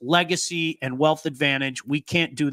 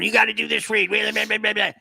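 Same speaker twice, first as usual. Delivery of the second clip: a hint of a pushy boss shouting; mock yelling Blake; 7 semitones higher than usual. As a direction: imitate a bossy producer barking orders. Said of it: "he's going".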